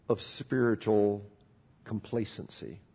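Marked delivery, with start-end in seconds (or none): none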